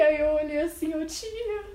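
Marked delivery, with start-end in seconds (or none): 1.1-1.6 high mock-tearful voice, laughing; hammed-up panic, pitched way up; retold desperate crying. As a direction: whimper to your aunt, then build to a loud wail. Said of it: "Eu chorando, eu chorando, desesperada".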